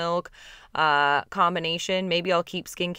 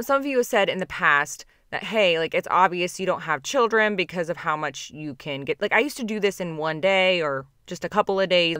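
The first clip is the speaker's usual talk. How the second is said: normal talking voice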